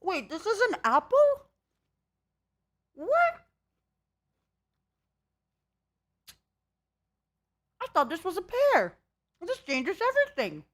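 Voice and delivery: shocked and high-pitched; surprised. Real, not acted. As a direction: genuine disbelief, pitch jumping up. Realises pear is an apple.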